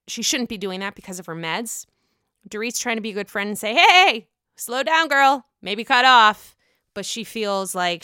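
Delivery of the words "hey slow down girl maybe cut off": friendly call-out. Retold or imitated. Dorit tells a drinking friend to slow down, friendly call-out.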